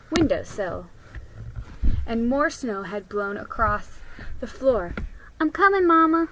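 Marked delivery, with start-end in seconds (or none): 5.4-6.3 put-on higher, louder little girl calling back; a young girl answering her mother eagerly; eager child. Child Addie answering Mama.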